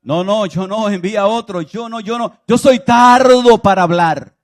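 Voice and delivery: loud, high protesting plea; Moses pleading. Acted. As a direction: beg out of the call. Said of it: "cuando llamó a Moisés".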